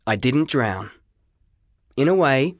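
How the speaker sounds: ordinary delivery, nothing marked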